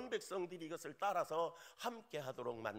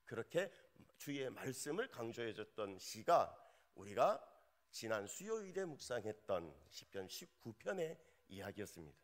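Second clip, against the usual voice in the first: normal talking voice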